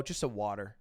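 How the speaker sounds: low, soft, tired mumble; mumbled quiet order. Retold or imitated. Exhausted guy quietly asks bartender for water, soft mumble. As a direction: say it small so nobody checks.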